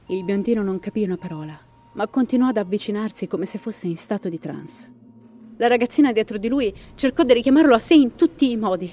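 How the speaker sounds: dramatic storyteller reading a ghost legend; story narration. A narrator's reading. Narrator telling listeners the Llorona legend, dramatic storytelling.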